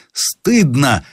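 high, indignant exclamation; возглас сержанта. A narrator's reading. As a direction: cry shame before the men. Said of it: "вскричал сержант". (возмущённый возглас)

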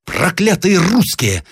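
slow, high furious curse; enraged general's outcry; angry shout. A narrator's reading. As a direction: curse them in a high, drawn-out cry. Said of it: "закричал генерал".

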